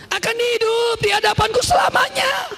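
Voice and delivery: very high, ringing preacher's cry; shouted proclamation. Real, not acted. Preacher proclaims God's promise to Eli's family, shouted high.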